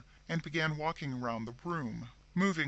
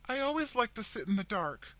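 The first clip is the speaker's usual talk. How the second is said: high, choking voice on the edge of tears; a tearful, choked reply; 8 semitones higher than usual. A narrator's reading. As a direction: force the words out through a tight throat. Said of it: "replied Rebecca chokingly".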